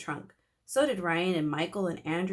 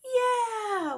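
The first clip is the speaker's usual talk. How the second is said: put-on high, loud kids' cheer; yelled; 5 dB louder than usual. A narrator's reading. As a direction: whoop with joy. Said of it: "we all yelled".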